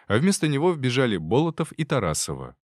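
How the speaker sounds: ordinary delivery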